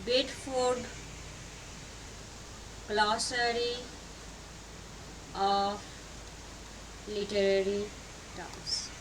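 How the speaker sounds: softly spaced out, a long pause after each word; quiet, low, piecemeal dictation. Real, not acted. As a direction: dictate it slowly as you write. Dictates while writing the name.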